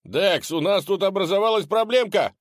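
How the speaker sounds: high, loud urgent call; urgent shout. Acted. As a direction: yell for your friend. Calling for help during attack.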